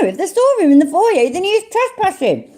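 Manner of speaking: talking through a mouthful of food; mouth full. Real, not acted. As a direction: say it round a bite of food. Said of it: "I got a mouthful of food just then".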